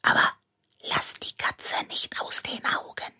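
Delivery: urgent hushed order; boy whispering instructions near the cat. A narrator's reading. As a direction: keep it to a breath, barely voiced. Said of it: "flüsterte er".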